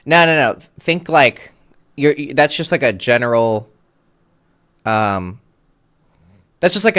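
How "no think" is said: usual speaking voice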